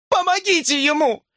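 very high-pitched, desperate cry. A narrator's reading. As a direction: scream for help in panic. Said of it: "закричала Брук". (отчаянно крича)

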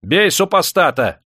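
loud, high, drawn-out war cry; heated shouting. A narrator's reading. Master urges the fighter to strike, heated shouting. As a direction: urge on the blow at full voice. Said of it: "азартно закричал хозяин".

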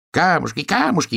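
high-pitched squealing hare; shrieky little storybook hare. A narrator's reading. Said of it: "верещал заяц".

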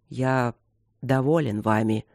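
low growling voice of a king. A narrator's reading. King to his kneeling men, in a low growl. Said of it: "сказал король рычаще".

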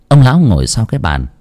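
plain reading voice

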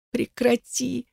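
high, strained plea; voice failing. A narrator's reading. Trapped woman begs Igar to stop, voice failing. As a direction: beg it high without shouting. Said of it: "Голос Элаза наконец-то перестал ей повиноваться".

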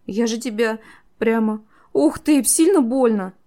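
high, halting, distressed exclamation; overwhelmed, rising to loud alarm; squeezed-out words. A narrator's reading. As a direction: stammer it out, voice climbing high. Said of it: "наконец выдавила из себя ясную мысль Энира".

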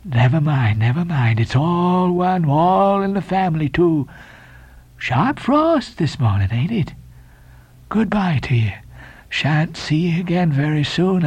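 slow, drawn-out, rambling farewell; Elijah, cracked prophet. A narrator's reading. Elijah departing with cracked words to the sailors, slow and eerie. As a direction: meander eerily through cryptic goodbyes. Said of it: "with these cracked words".

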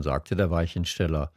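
ordinary delivery, nothing marked

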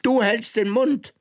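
very high, sharp furious order; furious queen. A narrator's reading. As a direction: bark her to silence in rage. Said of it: "krebsrot vor Zorn".

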